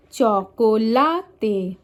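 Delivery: chocolate in slow Spanish, long stressed LA; stress on third syllable. Shown as a demonstration. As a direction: lean hard on LA: cho-co-LA-te. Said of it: "one syllable of a word is usually louder".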